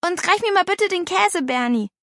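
put-on high girlish request; asking girl. A narrator's reading. Hungry girl asking her brother, casually. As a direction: ask lightly for something at table.

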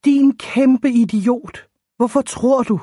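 acted lovers' quarrel outburst; mild exasperation. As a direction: scold him in frustration. Acted quarrel between the two lovers.

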